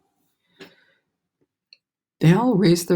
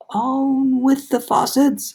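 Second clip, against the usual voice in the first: high, very slow booming far-off call; shouted command; 8 semitones higher, much slower than usual. A narrator's reading. Cloud man shouting down from the cloud, booming call. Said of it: "it shouted".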